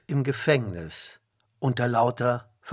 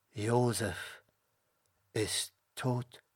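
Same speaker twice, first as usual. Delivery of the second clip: hushed, halting lament; low-volume, broken-up words of grief; grieving; 3 semitones lower, 5 dB quieter, slower than usual. A narrator's reading. Jakob sees his son's coat and weeps, grieving softly. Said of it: "weinte und schrie".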